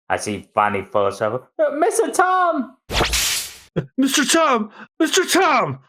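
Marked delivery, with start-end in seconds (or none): 1.6-5.8 very high shouted calls; anguished cries. Acted. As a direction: scream his name as he falls. Apprentices cry as Tom collapses.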